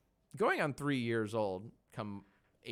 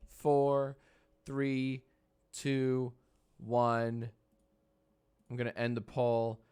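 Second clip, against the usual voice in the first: ordinary delivery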